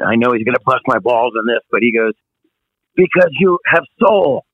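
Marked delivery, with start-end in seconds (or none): 3.0-4.4 higher, lightly imitated German accent; a hint of a German accent. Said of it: "he's got this German accent".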